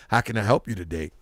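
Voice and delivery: put-on low, quick clerk in an American accent; brisk, deep-set imitation of an American accent; clerk's American accent. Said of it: "He had air accent".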